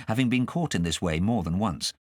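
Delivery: plain reading voice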